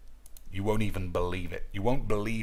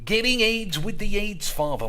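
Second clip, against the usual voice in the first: higher repeat; 10 semitones higher than usual. Acted.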